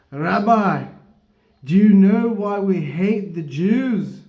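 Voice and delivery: loud, high, slow attempt at a Scottish accent; failed Scottish accent. Big Scotsman challenging the rabbi, loud mock Scottish accent. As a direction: boom it out in a bad Scottish accent. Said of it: "a failed Scottish accent".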